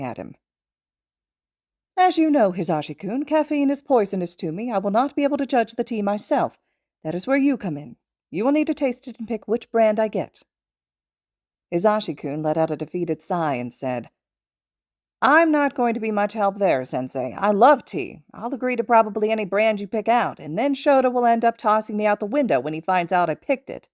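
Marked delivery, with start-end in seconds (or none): 2.0-10.4 higher, confident little mentor voice; Nezu, prim and certain. Acted. Nezu insisting to his student about tea, higher and confident. 15.2-23.9 a touch higher, easygoing grumble; Hisashi, resigned and amused. Acted. Hisashi protesting to Nezu about tasting tea, lightly resigned. Hisashi voiced in read story.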